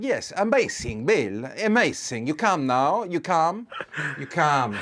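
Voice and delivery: put-on loud, high, gushing Italian-accented English. Fake Italian photographer calling the star out, loud Italian accent. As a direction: urge him on like an excitable Italian photographer. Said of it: "my skills on the Italian accent".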